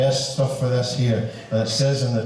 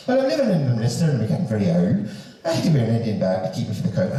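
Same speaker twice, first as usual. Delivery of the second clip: quick, swooping take in exaggerated standard English accent; mock proper English accent; faster than usual. Shown as a demonstration. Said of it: "better said like that than like this".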